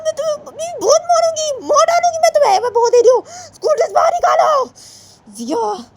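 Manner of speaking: very high, loud shrieking threats; a mother screaming at her child to hand over the dress; furious threats. Acted.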